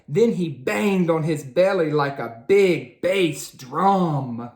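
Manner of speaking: ordinary delivery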